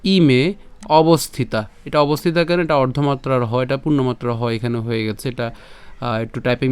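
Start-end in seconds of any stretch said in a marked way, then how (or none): 0.0-1.7 very slow, split into syllables; broken-up pronunciation. Shown as a demonstration. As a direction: say ইমে অবস্থিতা slowly, word by word. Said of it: "ভেঙে উচ্চারণ করি". (ভেঙে ভেঙে ধীরে উচ্চারণ)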